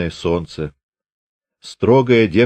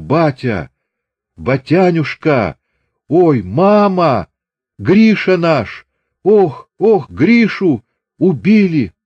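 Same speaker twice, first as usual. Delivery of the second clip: climbing to a high, loud, slow wail of grief; wailing scream; 8 semitones higher, 6 dB louder, slower than usual. A narrator's reading. Дочь читает похоронку отцу, срывается в отчаянный вопль. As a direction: howl it out as the news breaks her. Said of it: "крикнула дурным голосом".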